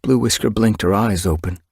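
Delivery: usual speaking voice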